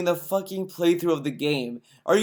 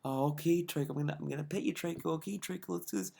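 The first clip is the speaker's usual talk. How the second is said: much softer, gentle; mock tender coaxing; 13 dB quieter, much faster than usual. Mocking Phil turning sweet to his game companion, soft and gentle. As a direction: coo to the pet creature softly. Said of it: "He started to be like".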